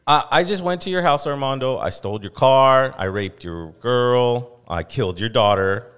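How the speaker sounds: loud, hammed-up thief confessing; mock confession. Host acting out a bad apology to Armando, loud mock confession. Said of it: "it's like me going".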